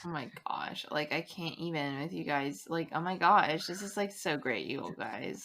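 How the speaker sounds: put-on fast breathless Valley Girl gush; Valley Girl accent. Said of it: "Valley Girl accent".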